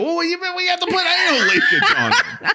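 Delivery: high, laughing exec voice; mock panicked executive. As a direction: blurt the bad fix. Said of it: "they go".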